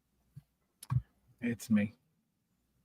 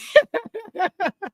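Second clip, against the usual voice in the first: high-pitched giggling laugh. Real, not acted. Streamer teasing Brad, high laughter. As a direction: burst into a high cackle.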